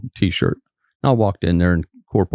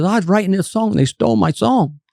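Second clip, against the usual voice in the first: higher, imitating an upset man's complaint; mock furious grievance, pitched up; retold angry Bobby; 12 semitones higher than usual. Bobby insisting to the trooper his song was stolen, mock angry. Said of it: "He just madder than hell".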